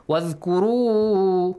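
measured tajweed recitation; Quran recitation. Shown as a demonstration. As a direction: chant with the final vowel of خلفاء held long. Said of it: "The mad is".

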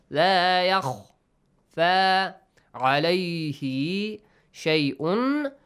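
شيء with the N hidden and nasal, slowly; ikhfa on tanween. Shown as a demonstration. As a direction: recite لا يخفى slowly with hidden noon. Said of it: "Tanween plus fa".

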